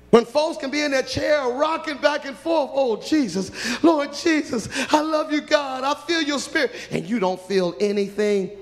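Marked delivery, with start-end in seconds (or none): 0.1-6.7 higher, louder cries of praise; mock the rocking worshipper's fervour; ecstatic worshipper. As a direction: cry out to Jesus in rapture. Mimicking worshippers crying out.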